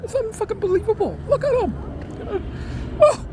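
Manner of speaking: cranky pet sounding off at the noise; [name] ranting. Acted. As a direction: rant indignantly as the cat. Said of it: "[name] is ranting".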